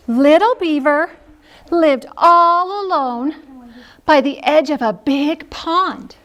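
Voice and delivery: slow, drawn-out storybook opening; teacher telling preschoolers a story; story reading to children. Acted. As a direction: read it like a bedtime story.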